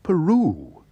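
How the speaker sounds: a startled, lifted cry of the word; surprised exclamation. A narrator's reading. Housekeeper hearing where the bear comes from, surprised exclamation. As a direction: catch your breath in surprise. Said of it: "exclaimed Missus Bird".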